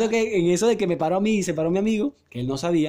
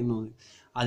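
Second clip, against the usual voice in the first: usual speaking voice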